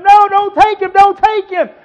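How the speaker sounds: high, loud acted cry; urgent, high-pitched protest from the pulpit; loud plea. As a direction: plead at the top of your preaching voice. Acted plea not to take him.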